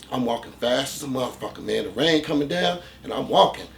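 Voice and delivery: usual speaking voice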